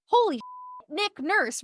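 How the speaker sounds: high, startled outburst at a celebrity; shocked blurt. Retold or imitated. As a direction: blurt out his name in disbelief. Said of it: "I was so shocked. I went".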